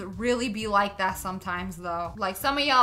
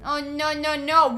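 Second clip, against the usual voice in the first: ordinary delivery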